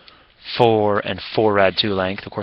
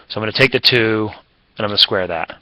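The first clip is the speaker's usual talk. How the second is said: ordinary delivery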